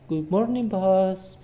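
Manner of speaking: high, bright greeting; lightly voiced cheerful employee; cheerful Andrea. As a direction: chirp a sunny hello to the boss. Andrea greets her boss.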